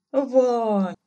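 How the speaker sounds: high, loud plea; imploring. A narrator's reading. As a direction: implore your son in one word. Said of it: "взмолился отец". (умоляюще, громко)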